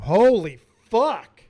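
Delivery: slow, loud, amazed curse; drawn-out loud shock; stunned. Real, not acted. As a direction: let it out in disbelief. Stunned by emotional promo.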